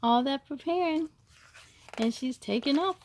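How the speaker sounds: ordinary delivery, nothing marked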